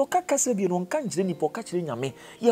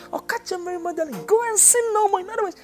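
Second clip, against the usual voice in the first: high pulpit proclamation swelling very loud; fervent preaching; 10 semitones higher, 9 dB louder than usual. Real, not acted. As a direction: drive the command home with a ringing shout. Preaches scripture with force.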